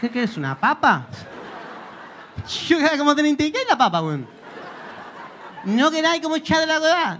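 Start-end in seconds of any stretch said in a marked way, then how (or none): 5.6-7.2 higher mock Chilean sing-song; Chilean accent. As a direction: imitate a Chilean buddy. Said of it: "el acento es raro". (acento chileno imitado, agudo)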